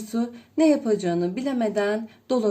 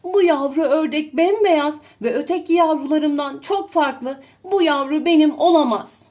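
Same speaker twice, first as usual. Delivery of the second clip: loud, higher, dismayed mother duck; upset outcry; 4 semitones higher, 7 dB louder, faster than usual. A narrator's reading. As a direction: protest loudly at the odd duckling. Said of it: "diye haykırır". (anne ördek telaşla yüksek sesle)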